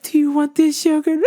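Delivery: high-pitched mock-mom question, rising; coaxing mother imitation. Mother offering her child yogurt, high mock coaxing.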